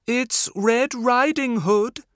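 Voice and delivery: put-on high, loud girlish voice from the wolf; wolf squeaks like a little girl; wolf faking a girl. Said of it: "said the wolf".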